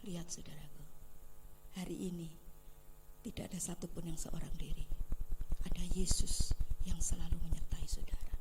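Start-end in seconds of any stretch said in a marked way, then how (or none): 0.1-2.3 low hushed whisper. Real, not acted. Hushed appeal in sermon. 5.7-8.3 lower, breath-only whisper; intense whisper. Real, not acted. Hushed assurance in sermon.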